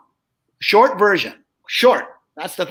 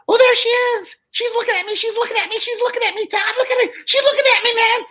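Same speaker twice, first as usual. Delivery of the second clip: high squeaky dummy voice; excited little puppet voice, pitched way up; squeaky ventriloquist dummy. Retold or imitated. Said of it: "Joe would say".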